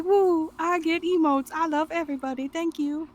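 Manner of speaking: high-pitched, playful little celebration; mock-giddy thanks. Acts out getting gifted subs.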